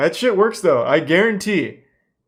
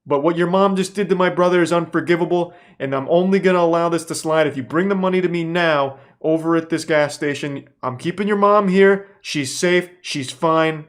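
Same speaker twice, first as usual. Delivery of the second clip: loud, higher, menacing caller; threatening scammer; 4 semitones higher, 4 dB louder than usual. Retold or imitated. Scam caller threatening him over the phone, louder and higher. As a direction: lean in hard like a phone extortionist. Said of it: "He's like".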